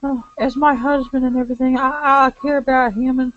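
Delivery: high-pitched put-on lady's voice; Sonya's diary voice. Sonya confiding worry for her soldier husband, high put-on voice. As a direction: read the diary high, in character.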